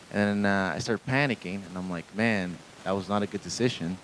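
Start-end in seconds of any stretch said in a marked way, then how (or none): 2.2-4.0 low, subdued voice of thinking to oneself; inner monologue. Acted. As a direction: murmur it like a private thought. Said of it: "just thinking in my head".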